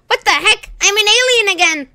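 high, loud alarm; panicked surprise. Acted. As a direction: gasp and panic. Transformed back.

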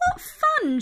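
very high, bright squeak of a little magpie. A narrator's reading. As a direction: pitch way up for the cheerful bird. Magpie Meg's excited reply.

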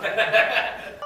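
loud, high burst of laughter; real laughter. Real, not acted. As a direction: crack up loudly at a blooper. Cast cracking up in outtakes.

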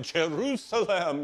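high, slow and thrilled; old man savouring the verse, excited. Retold or imitated. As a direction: savour the word "Jerusalem". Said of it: "he was so excited".

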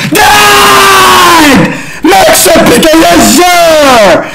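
high, loud, breathless cheering; screaming with excitement at a teammate; hyped yelling. Real, not acted. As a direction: yell it out like you just won.

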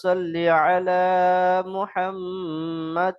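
slow, long-held chanted syllables; Quran-style recitation. Shown as a demonstration. Teacher modelling the durood for students to repeat, slow measured recitation. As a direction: recite it slowly, drawing out every long vowel.

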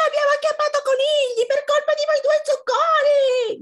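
shrieking, very high little fox; furious high-pitched yell; shouting little fox. A narrator's reading. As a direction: scream the blame in a tiny squeaky voice. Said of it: "gridò Volpe Piccola".

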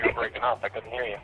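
ordinary delivery

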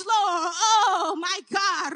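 ordinary delivery

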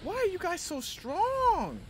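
very high, slow, drawn-out whine; exasperated wail. Real, not acted. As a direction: wail it at enemies who are too strong. Complains to enemies beating him.

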